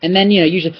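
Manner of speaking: normal talking voice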